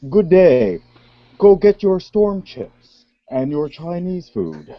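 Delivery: over-enunciated Ontario accent; hammed-up, carefully articulated Ontario accent. Shown as a demonstration. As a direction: stretch every word and syllable in a put-on Ontario accent. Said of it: "enunciate every word and syllable as I talk".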